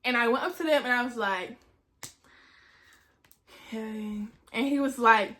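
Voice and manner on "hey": small, soft greeting; sheepish. Retold or imitated. Student in trouble approaches her instructors, sheepish and quiet. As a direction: creep up timidly to someone in charge.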